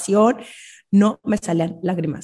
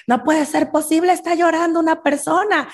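higher, incredulous; mock outrage; 9 semitones higher than usual. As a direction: act scandalized by someone crying. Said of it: "Es de". (incredulidad fingida, más aguda)

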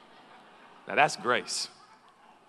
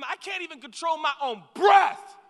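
loud, higher burst; exasperated shout; 7 semitones higher, 7 dB louder than usual. Real, not acted. Preacher telling the congregation his story, exasperated shout. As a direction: throw it out exasperated.

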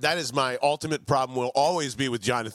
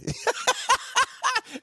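high burst of laughter; high-pitched incredulous laughing fit; real laugh. Real, not acted. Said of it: "I'm laughing now".

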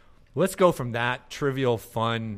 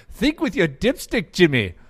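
much higher, loud Scottish bellow; angry Scottish shout; 10 semitones higher, 8 dB louder than usual. Retold or imitated. Scottish ad guy ambushing a customer, loud angry yell. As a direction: burst in yelling like the mad Scot. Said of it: "the Scottish guy would drop out of nowhere and go".

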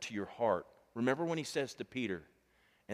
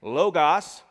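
"Logos" called out loud; loud repeat; 5 semitones higher, 11 dB louder than usual. Shown as a demonstration. Preacher drills the word, calling "Logos" loud. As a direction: congregation answer, "Logos" called out. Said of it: "You slowed down on written there".

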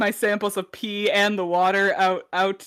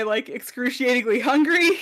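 ordinary talk, nothing marked

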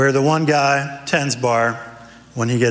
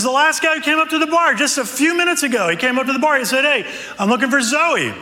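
a much higher, quick, amazed bartender telling it; excited bartender; 11 semitones higher, faster than usual. Acted. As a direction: rush it out, amazed. Bartender retells in the joke.

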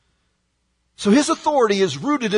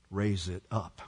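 hushed; 10 semitones lower, 12 dB quieter than usual. Acted. Climactic quiet point.

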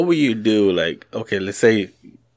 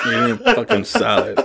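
laughing; 11 semitones higher than usual. Real, not acted. Laughs at himself eating salad.

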